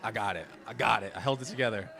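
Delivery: ordinary talk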